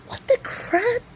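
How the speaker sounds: high, baffled reaction without extra volume; retold disbelief. Shopper reacting to the yelling, high retold disbelief.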